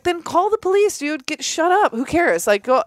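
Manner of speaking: high, exasperated brush-off; dismissive brush-off. Retold or imitated. Telling the neighbor to just call the police, exasperated. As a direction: wave him off, sick of it. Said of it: "I was like".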